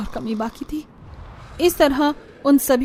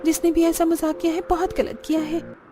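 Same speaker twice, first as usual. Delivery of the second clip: nervous; 6 semitones higher than usual. Acted. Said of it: "nervous होती हुई कहती हैं".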